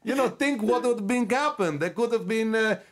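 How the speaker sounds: high put-on accent voice, laughing and loud; fawning foreign interviewer. Same character continues.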